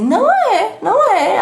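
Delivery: exaggerated indignant denial; mock protest. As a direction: imitate someone objecting in disbelief. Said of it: "alguém vai dizer assim".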